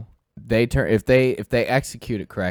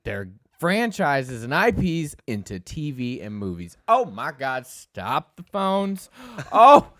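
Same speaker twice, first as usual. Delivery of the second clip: mock-amazed, higher and drawn out; exaggerated excitement; 6 semitones higher, slower than usual. Teased host overselling the gaming news, exaggerated excitement. Hamming up excitement about the headline.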